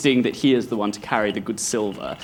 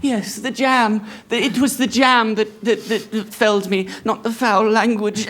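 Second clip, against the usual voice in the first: prim lady; 10 semitones higher than usual. Acted. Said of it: "she goes".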